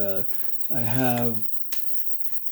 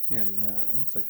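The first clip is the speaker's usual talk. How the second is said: normal talking voice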